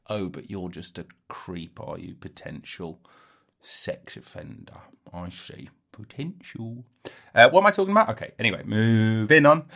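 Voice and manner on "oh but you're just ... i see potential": soft, quiet suspicious muttering; mock quiet aside. Imagined listener eyeing the comedian, soft and suspicious. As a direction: murmur it as a wary aside. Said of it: "And then you're like".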